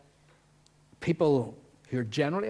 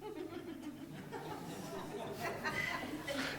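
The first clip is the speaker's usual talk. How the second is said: gossipy giggle; 13 dB quieter than usual. Acted. Mock gossip scene.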